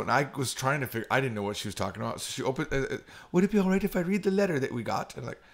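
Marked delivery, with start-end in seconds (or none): 3.3-5.2 put-on maid's line in a slight English accent. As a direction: ask politely with a hint of English accent. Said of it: "I almost went into, like, an English accent".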